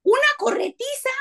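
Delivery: high, breathless amusement; excited. Retold or imitated. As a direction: squeal the chase out. Excited climax of the story.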